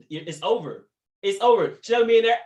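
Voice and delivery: ordinary delivery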